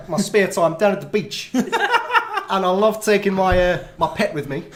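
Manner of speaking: put-on South African accent, laughing through it; playful South African accent, cracking up. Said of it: "That was very good".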